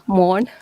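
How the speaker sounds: morn, G gone; Danish word fully. Shown as a demonstration. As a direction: say morn with the G swallowed. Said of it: "say the Danish one fully again".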